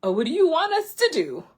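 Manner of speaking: mock panic, freaking out; panicked disciples. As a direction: disciples panicking in front of Jesus, loud and flustered. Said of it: "And they're just like".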